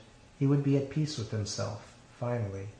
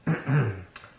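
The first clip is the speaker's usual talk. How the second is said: throat clearing. A narrator's reading. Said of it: "the sound of a throat clearing".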